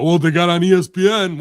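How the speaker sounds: loud put-on excuse-maker voice; mock retort. Host mimics defenders of the company, loud mock retort. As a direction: throw it out loudly, mocking the defenders.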